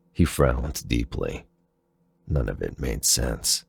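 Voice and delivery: ordinary delivery, nothing marked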